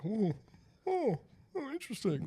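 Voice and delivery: high airy snob voice; mock a pompous gallery-goer, high and breathy; pretentious art snob. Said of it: "Like some douchebag just standing there".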